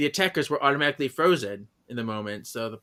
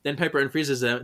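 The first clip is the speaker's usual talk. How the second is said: normal talking voice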